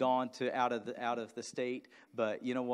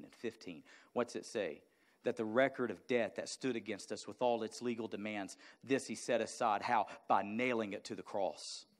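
ordinary talk

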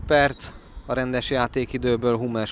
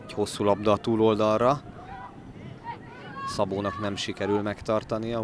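ordinary talk

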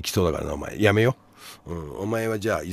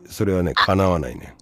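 ordinary delivery

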